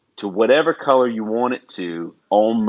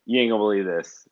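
ordinary talk

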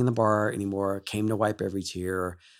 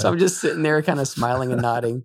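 amused; 5 semitones higher, 7 dB louder than usual. Real, not acted. Laughing at his own confusion.

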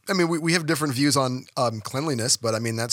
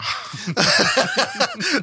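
laughing; 11 semitones higher than usual. Real, not acted. High laugh after joke.

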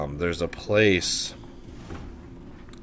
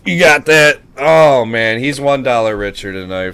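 excited; 3 semitones higher, 9 dB louder than usual. Real, not acted. Hyping up a dollar winner.

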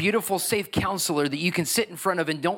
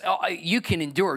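ordinary talk

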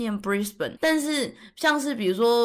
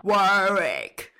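imitating husband's Australian. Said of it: "我有请教我们家尼哥吼".

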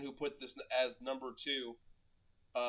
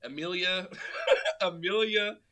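cracking up while saying a name; laughing; 5 semitones higher, 6 dB louder than usual. Real, not acted. Laughing at hated character.

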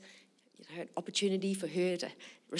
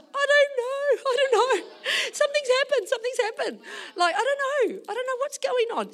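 high, loud mock wailing; imitated tearful outburst, much higher; crying mother; 6 dB louder than usual. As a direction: blubber it like an overwhelmed mum. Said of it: "She starts crying again".

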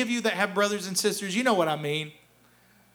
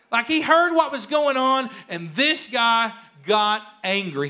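ordinary delivery

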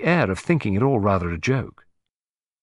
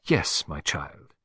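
pleased; 7 semitones lower, 6 dB quieter, much slower than usual. A narrator's reading. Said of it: "vain enough to be pleased".

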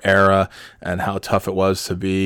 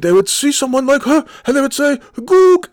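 imitated professor screaming, very high and loud; mock screaming; 6 dB louder than usual. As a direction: shriek it as the ranting professor. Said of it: "he starts screaming at the top of his lungs".

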